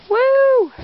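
high, loud squeal of delight; excited whoop. Real, not acted. Kid showing her toy collection, giving a high excited whoop. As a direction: cheer as if the toy just won. Cheering between toys.

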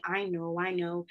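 low, soft grumble to himself; mumbling. A narrator's reading.

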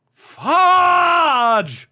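long, high cry of "Fudge"; drawn-out exasperated howl; frustrated yell. Real, not acted. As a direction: yell a substitute swear word. Loses the game, mock swearing.

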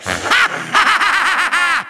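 triumphant villain laugh; evil cackle. Acted. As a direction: cackle in a rapid rolling burst. Villain mocks the loser.

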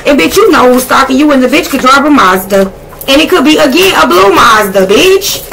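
getting hyped over the reading; hyped-up call-out. Real, not acted. Reading tarot cards to Virgo viewers, building excitement.

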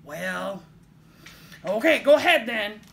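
loud, grudging troll. A narrator's reading. Troll letting the little goat pass, loudly grudging. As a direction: give in with a loud grumble. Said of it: "said the troll".

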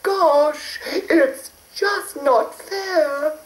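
high hurried fairy; a sweet high fairy in a rush. A narrator's reading. Fairy sulks.

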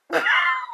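high-pitched burst of laughter. Real, not acted. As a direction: let a squeaky laugh burst out.

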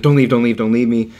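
retold desperate plea. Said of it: "totally freaked out".

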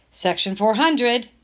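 plain reading voice

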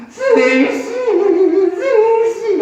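long, high, wailing melodrama call; plaintive plea. Shown as a demonstration. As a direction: draw the name out pleadingly, rising high. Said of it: "애절하게". (길게 늘여 애절하게)

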